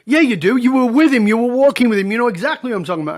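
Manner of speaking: loud, high, accusing retelling; heated insistence. Retold or imitated. Acted quarrel, friend insists he lied.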